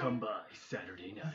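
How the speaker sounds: announcer voice. Acted. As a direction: sell the show like an announcer. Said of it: "I love doing announcer voices".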